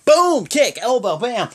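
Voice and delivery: high, loud punchy shouts; hyped move calls. Retold or imitated. Fan replaying the fight blow by blow, hyped shouts. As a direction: bark out each hit like a commentator. Calling out wrestling moves.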